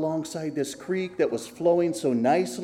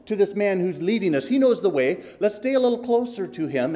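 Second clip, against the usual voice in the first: quick, higher, urging voice; 5 semitones higher, 7 dB louder, faster than usual. Acted. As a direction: press forward eagerly.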